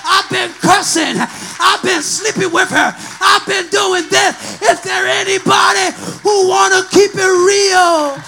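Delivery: rousing preacher calling the congregation to respond; full-force preaching. Acted. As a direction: preach hard, punching and stretching key words.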